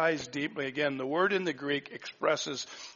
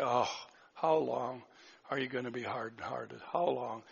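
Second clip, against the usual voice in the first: weary, sighing lament, sinking softer; deep sigh, exasperated; 3 semitones lower, 4 dB quieter than usual. Acted. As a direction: sigh out the words as if burdened and tired of it. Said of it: "Deep sigh".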